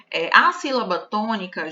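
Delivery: normal talking voice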